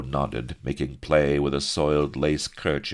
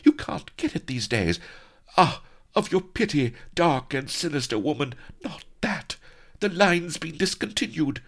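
high-pitched pleading wail; quavering; 13 semitones higher than usual. A narrator's reading. Captive lady pleading for her discontinued cosmetics, quavering. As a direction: beg in a very high, distressed voice. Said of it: "she quavered".

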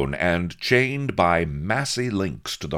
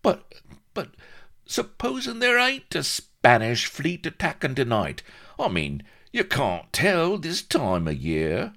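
high squeaky stammer of objection; fretful little sidekick raising doubts; Vladimir, worried; 12 semitones higher, 4 dB louder than usual. Acted. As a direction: protest nervously in a high-pitched voice.

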